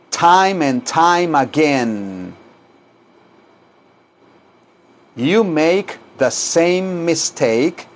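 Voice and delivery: very slow, stretched teaching delivery; slow repeat-after-me model. Shown as a demonstration. Teacher models phrases for students to say, very slow. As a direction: draw out each phrase for learners to echo. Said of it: "Díganlo".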